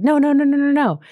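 high, rising mock-panicked no's. Bitter Blue panicking at Lek's question, mock-frantic pleading. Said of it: "really starts, like, panicking and freaking out".

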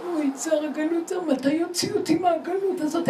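lamenting Shechina. Retold or imitated. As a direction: moan it like a mother grieving in exile. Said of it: "והיא אומרת".

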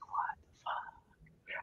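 slow, breathless whispered disbelief; stunned whisper. Retold or imitated. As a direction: whisper it like you can't believe your eyes. Narrator's stunned reaction to kid.

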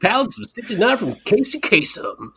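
high, projected countdown-host voice, hammed up; Casey Kasem radio voice. Co-host joking over a countdown, mock radio voice. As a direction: put on a playful high-pitched radio DJ voice. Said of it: "That's my radio voice".

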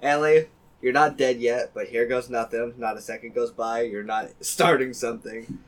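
poem reading. Acted. Reads second epitaph poem.

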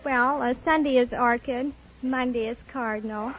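ordinary talk, nothing marked

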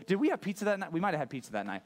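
normal talking voice

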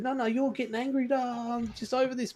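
high mock-exasperated outburst; retold angry yelling. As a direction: end the argument in high, hammed-up exasperation. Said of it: "then I started yelling at him".

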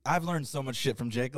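ordinary talk, nothing marked

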